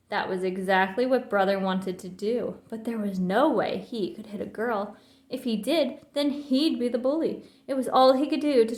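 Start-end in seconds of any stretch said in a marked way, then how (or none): none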